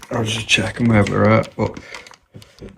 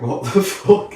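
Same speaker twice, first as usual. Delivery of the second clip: high, startled "what the fuck"; shocked disbelief; 9 semitones higher, slower than usual. Retold or imitated. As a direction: blurt it out in sudden disbelief. Retelling shocked reaction to rumour.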